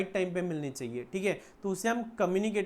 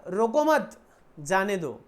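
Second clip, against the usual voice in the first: "रोको मत" as one raised, louder unit, pause, then जाने दो; group मत with रोको, then drop for जाने दो; pause after मत; 5 dB louder, slower than usual. Shown as a demonstration. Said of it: "उसने ऐसा इंटरप्रेट किया". ("रोको मत" साथ में, फिर विराम, "जाने दो")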